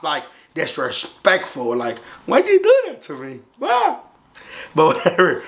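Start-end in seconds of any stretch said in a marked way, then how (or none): 2.3-4.0 very high plaintive whine; acted-out aggrieved squeal; mock wounded protest. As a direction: plead it up high, hammed-up hurt. Mock protest at disrespectful spit.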